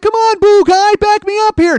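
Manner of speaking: high, loud acted appeal; mock plea for backup. Comic turns to Boo Guy for support, mock plea. Pleading for support, turning round.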